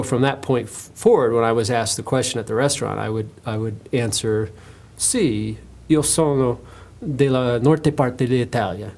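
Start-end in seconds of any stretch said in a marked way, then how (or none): 5.0-8.9 slower, mock Italian waiter voice; put-on Italian. Redheaded waiter posing as Italian to diners, put-on Italian. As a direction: play up being Italian, in Italian. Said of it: "I would carry it as".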